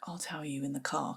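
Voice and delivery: low, hushed, holding something back; hushed secretive. Retold or imitated. Husband quietly putting off telling his wife until the car, hushed. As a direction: say it under your breath, saving the news.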